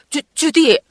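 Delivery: high, loud stammer of shock; startled. A narrator's reading. Friend walking in on Judy undressed, stammering in shock. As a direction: stumble on the name, taken aback. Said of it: "너무 깜짝 놀라 어쩔 줄 몰랐다".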